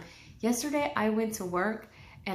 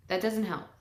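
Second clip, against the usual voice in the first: ordinary talk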